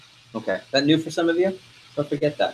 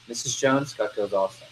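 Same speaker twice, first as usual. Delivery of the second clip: unenthusiastic pitch; 7 dB quieter than usual. Shown as a demonstration. Salesperson showing poor voice inflection to customer, soft and unenthusiastic. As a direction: say it half-hearted, trailing down. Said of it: "Voice inflection".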